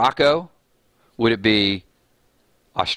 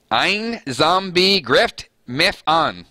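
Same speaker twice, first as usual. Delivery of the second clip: slow, higher, mangled German; butchered German read with a Deep South drawl; German with a Southern twang; 5 semitones higher, much slower than usual. Shown as a demonstration. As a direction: sound out "Ein Zombie grift mef an" slowly in a Southern accent. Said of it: "I'm gonna put a little Deep South flavor".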